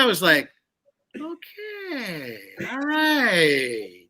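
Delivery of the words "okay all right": soft, high, drawn-out concession; imitating wry amusement. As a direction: let each word slide slowly down, quietly amused. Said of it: "there's this wry smile".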